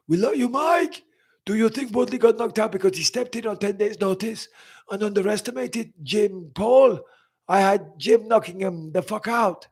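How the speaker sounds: high-pitched, hammed-up foreign accent; exaggerated accented fighter impression, high voice; Yoel Romero impression. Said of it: "I did a Yoel Romero impression".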